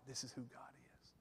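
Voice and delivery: fast whispered rattle-off; rapid whisper. Retold or imitated. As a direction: rattle it off under your breath.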